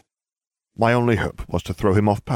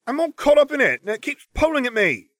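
much higher panicked voice; panicked Ron. A narrator's reading. Ron trapped in vines calls up, panicked. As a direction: caught and frightened.

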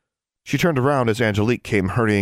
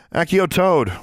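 higher, raised incantation; calling out; 10 semitones higher, 4 dB louder, slower than usual. A narrator's reading. Girl casting into each lavatory, calling out. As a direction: call out a summoning spell.

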